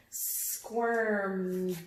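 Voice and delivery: "Squirmed" said slowly, ending on a clear D sound. Shown as a demonstration. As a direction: stretch "Squirmed" and land on the D at the end. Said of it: "It's the duh sound at the end".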